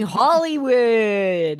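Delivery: high, drawn-out "Hollywood"; hammed-up showbiz flourish. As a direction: stretch the word out theatrically. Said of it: "that voice for Nate was giving".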